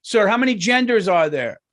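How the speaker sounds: high, loud mock street interviewer; spring the question on him out of nowhere; abrupt questioner. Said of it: "just real abruptly".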